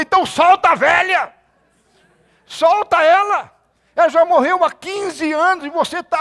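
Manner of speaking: high, loud commanding shout; retold shout. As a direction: yell at her to let her mother go. Preacher shouting at the woman. (grito alto mandando soltar a mãe)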